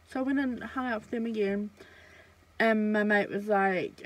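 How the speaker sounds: ordinary talk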